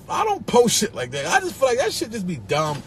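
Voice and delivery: usual speaking voice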